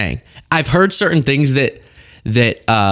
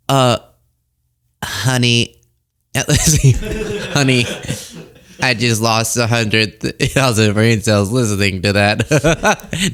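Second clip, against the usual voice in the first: lightly voiced condescending pundit, slow and a bit lower; a hint of a patronizing TV host, drawn out; mock-sweet put-down, barely changed from own voice; Laura Ingraham impression; 3 semitones lower, slower than usual. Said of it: "how do I do her? ... an impression of her".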